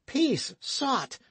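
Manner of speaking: high, sharp angry cry; sharp rebuke. Acted. Dick silencing the drunken outlaw, angry. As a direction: cut the drunk off hard. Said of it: "cried Dick".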